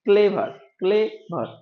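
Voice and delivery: ক্লেভার said slowly as two separate beats, ক্লে and ভার; slow it down so the two syllables of ক্লেভার stand apart; split into syllables. Shown as a demonstration. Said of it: "দেখো দুই ভাগে ভাগ আছে".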